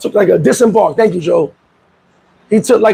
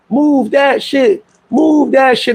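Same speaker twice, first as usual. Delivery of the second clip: retold yelling; 4 semitones higher, much slower than usual. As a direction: imitate boat passengers yelling, each word stretched. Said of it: "they're yelling on the boat".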